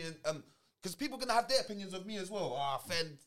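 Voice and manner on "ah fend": usual speaking voice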